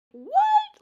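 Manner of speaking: sudden very high, loud disbelief; shocked "what". Retold or imitated. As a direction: jump up in pitch, floored. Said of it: "and I'm like".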